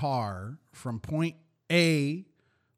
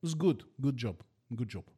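put-on Russian accent. Salesman Yuri pitching to a customer in Russian accent. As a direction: sell it hard in a thick mock Russian accent. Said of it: "do my Russian accent".

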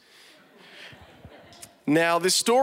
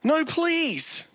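much higher desperate plea; pleading son; 10 semitones higher than usual. A narrator's reading. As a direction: cry out like a scared kid. Terrified son pleads with dad.

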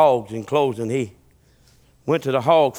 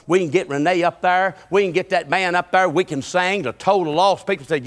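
ordinary talk

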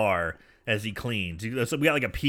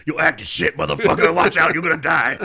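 louder, gruff mock threat; 7 dB louder than usual. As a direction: snarl it like a phone stalker. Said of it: "someone saying".

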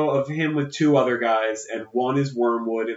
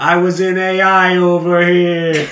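slow, louder Brooklyn wiseguy drawl; mock Brooklyn Jude Law; 4 semitones higher, 6 dB louder, slower than usual. Imagining Jude Law as the Pope, mock Brooklyn accent. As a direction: ham up a Brooklyn tough guy. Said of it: "he's doing that accent".

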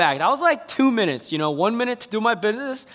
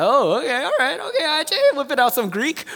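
much higher, mock-impressed; teasing audience; 6 semitones higher than usual. Congregation reacting to the Greek lesson, mock-impressed. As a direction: mimic a listener rolling their eyes. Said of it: "some of you are like".

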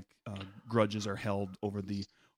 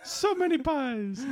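cartoon Italian waiter; 13 semitones higher, slower than usual. Echoes the waiter voice.

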